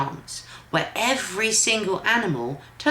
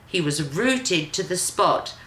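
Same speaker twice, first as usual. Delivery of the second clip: ordinary delivery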